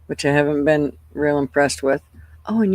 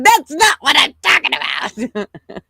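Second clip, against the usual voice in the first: higher, retorting as the granddaughter; imitating granddaughter; 10 semitones higher, 3 dB louder than usual. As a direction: mimic her comeback. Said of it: "She said".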